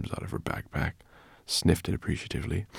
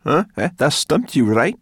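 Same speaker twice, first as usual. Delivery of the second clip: loud, raised, growly jeer; growled taunt; 10 semitones higher, 10 dB louder than usual. A narrator's reading. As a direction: growl it at your captive, goading. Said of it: "she growled".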